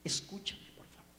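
hushed, fading to a near whisper; calm her down very softly; retold soothing. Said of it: "le dije".

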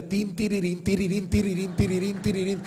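mimicked tambourine jingle, tin tirirín; tambourine jingle imitation. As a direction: rattle it off like an endless tambourine. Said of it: "suena y suena".

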